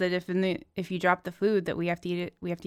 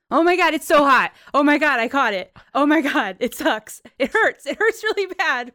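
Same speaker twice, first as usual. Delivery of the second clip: high, loud yelps of burning pain; pained yelping; 7 semitones higher, 7 dB louder than usual. Acted. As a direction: yelp like your hands are burning. Caught a hot turkey pan.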